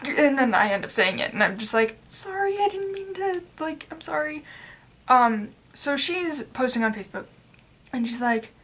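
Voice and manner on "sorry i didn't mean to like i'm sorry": high, soft little sorry; sheepish apology. Retold or imitated. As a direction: apologise meekly, like a guilty child. Apologising after swearing.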